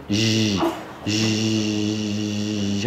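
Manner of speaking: long, low buzzing zh; soft continuous jim. Retold or imitated. Teacher isolates the faulty soft sound, continuous zh. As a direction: hold a quiet zhhh without any stop. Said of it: "رخو".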